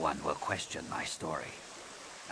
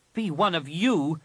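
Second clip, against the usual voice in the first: loud, higher mocking question; sneering; 10 semitones higher, 6 dB louder than usual. A narrator's reading. The captive Jedi mocks the Master Shaper, sneering. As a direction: spit the question back with scorn. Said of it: "the Jedi sneered".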